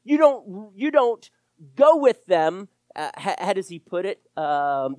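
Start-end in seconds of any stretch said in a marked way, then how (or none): none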